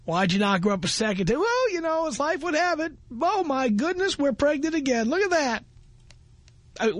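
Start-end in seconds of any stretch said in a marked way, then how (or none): 1.4-5.6 mocking Joe. Mocking Joe's letter in voice.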